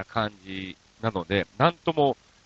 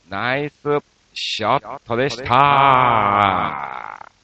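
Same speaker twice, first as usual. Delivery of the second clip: drawn-out, slow, louder sign-off on ショット; show catchphrase; 5 dB louder, much slower than usual. Acted. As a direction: stretch the last word like a radio tag line.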